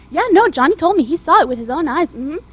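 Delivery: loud, eager gossiping mom. Acted. Mom on the phone spreading gossip, loud and eager. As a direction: broadcast the news like a gossip.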